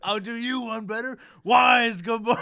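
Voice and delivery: high, loud comic yell; mock shout. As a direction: shout it as a joke punchline. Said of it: "Doc's like".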